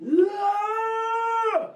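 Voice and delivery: a scream from a dropped larynx, held long; throat-dropped scream. Shown as a demonstration. Showing how her scream really sounded, strained high held scream. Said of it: "It's like".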